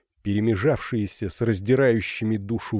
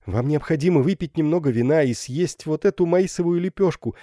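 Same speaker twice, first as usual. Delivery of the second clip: higher, coaxing insistence; insisting; 6 semitones higher than usual. A narrator's reading. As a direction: press her kindly but firmly. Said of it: "настаивала Ударда".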